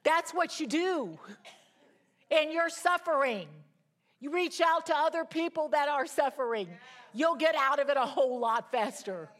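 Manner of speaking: high, loud, emphatic preaching. Real, not acted. Preacher urging women to reach out while suffering, forceful. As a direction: drive it home to the congregation at full force.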